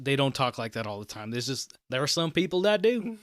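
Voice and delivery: mild put-on Southern accent. Shown as a demonstration. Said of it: "I don't know how to get out of this voice now".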